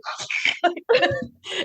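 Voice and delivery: a real, high burst of embarrassed laughter; high giggle of embarrassment. Real, not acted. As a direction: laugh helplessly at yourself, way up high. Laugh at crushed autograph.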